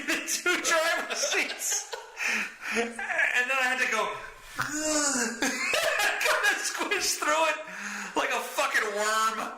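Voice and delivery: high-pitched giggling through the words, slowed; cracking up while confessing; laughing. Real, not acted. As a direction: laugh your way through it, squeaky and slow. Laughing while telling embarrassing story.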